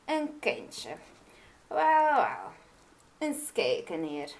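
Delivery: lightly voiced old witch pondering; mild witch voice. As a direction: witch considers the queen's wish, half to herself. Witch muses.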